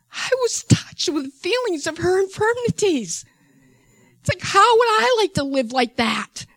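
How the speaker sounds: high, loud, impassioned voice; emotional testimony. Real, not acted. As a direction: testify with anguish, voice lifted. Emotional testimony of weeping for her.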